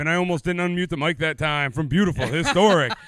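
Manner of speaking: loud hype intro; announcer intro. Acted. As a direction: sell the location. Stream opener, louder intro.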